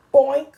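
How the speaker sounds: loud, bouncy boink sound effect. Pretend. Teacher making a bump noise for the fallen monkey.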